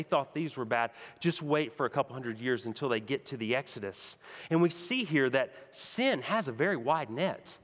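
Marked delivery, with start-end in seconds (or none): none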